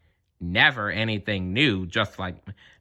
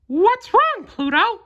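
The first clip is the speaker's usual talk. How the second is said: very high, louder concerned cartoon mouse; Mickey Mouse worried about his dog; Mickey's voice; 4 dB louder, slower than usual. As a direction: ask your dog what's wrong, squeaky and high. Mickey asks Pluto.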